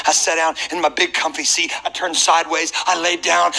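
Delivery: ordinary talk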